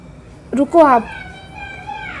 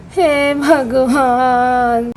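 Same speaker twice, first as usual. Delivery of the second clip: dramatic lament; 2 semitones lower, much slower than usual. Acted. Aunt laments again.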